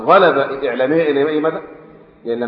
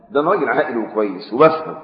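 usual speaking voice

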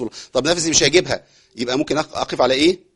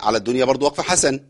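ordinary talk, nothing marked

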